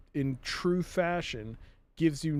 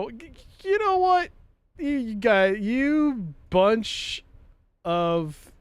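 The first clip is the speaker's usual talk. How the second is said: stammering, loud angry sputter; shaking with anger; 4 semitones higher, 5 dB louder, slower than usual. Pretend. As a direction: sputter in rage. Sputtering rage.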